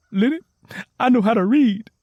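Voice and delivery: high, loud and bursting with news; excited. A narrator's reading. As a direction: blurt it out, thrilled to share. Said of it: "Tangy May said excitedly".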